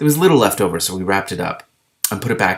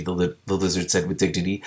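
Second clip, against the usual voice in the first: plain reading voice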